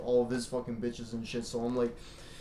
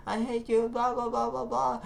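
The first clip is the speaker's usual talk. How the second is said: mock girly voice; 10 semitones higher than usual. Mocking a woman who hates men.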